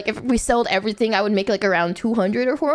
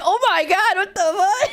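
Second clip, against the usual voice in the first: mock shock; 7 semitones higher than usual. Retold shock at flying from America.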